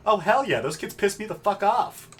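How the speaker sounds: hammed-up furious yell; angry outburst. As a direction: blow up at the bratty kids. Outburst about the kids.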